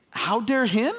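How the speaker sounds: high, rising mock outrage; incredulous. Onlooker questioning the customer's outrage, incredulous. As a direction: throw it back as an absurd question.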